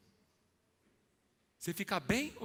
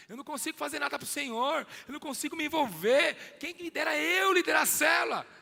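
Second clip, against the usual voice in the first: rising, higher and louder despair; anguished outcry; 10 semitones higher, 9 dB louder than usual. Acted. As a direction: build from lament to a shout. Pedro lamenting his failures.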